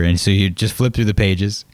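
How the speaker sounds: ordinary talk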